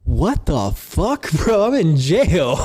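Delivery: high, amazed, laughing through it; can't believe what's happening; laughing, incredulous squeal; laughing disbelief. Retold or imitated. Amazed at guard's kindness.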